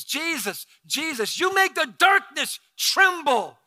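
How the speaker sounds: high, loud proclaiming prayer; worship prayer at full force. Acted. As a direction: lift his name in praise.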